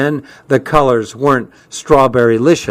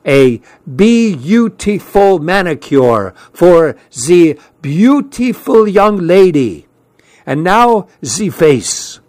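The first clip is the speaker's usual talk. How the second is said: put-on slow hammy French accent; a fake French accent, drawn out; bad French accent; 5 semitones higher, slower than usual. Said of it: "in a bad French accent".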